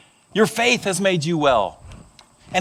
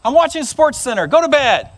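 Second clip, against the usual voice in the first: high, loud exasperated dad; irritated father shouting his refusal; dad hollering upstairs; 5 semitones higher, 3 dB louder than usual. Acted. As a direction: holler it up the stairs. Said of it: "the dad hollered up".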